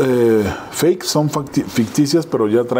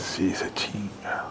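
breathy, barely audible aside, much softer; whispered aside; 3 semitones lower, 13 dB quieter, much slower than usual. Real, not acted. Guest telling a scary bar story whispers an aside. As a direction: drop to a hush for the aside.

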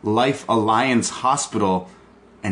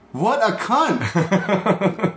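very slow, higher, drawn-out contempt; disgusted; 10 semitones higher, much slower than usual. Real, not acted. Fan appalled by the heel's wave, dragged-out disgust. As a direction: stretch every word out in utter disgust.

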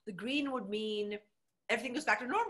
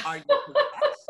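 very high, loud, slow mock shriek; laughing squeal of disbelief; incredulous squeal; 12 dB louder, slower than usual. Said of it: "I thought".

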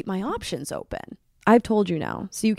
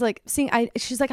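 ordinary talk, nothing marked

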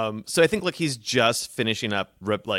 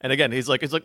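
ordinary talk, nothing marked

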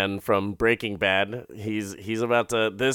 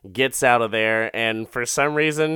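normal talking voice

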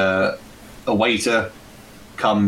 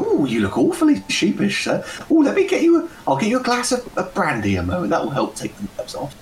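much higher, sing-song tavern hostess; flirty barmaid; 12 semitones higher than usual. Acted. Barmaid Lady Margaret welcomes the party, high and flirtatious. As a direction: greet the gents coyly, voice high.

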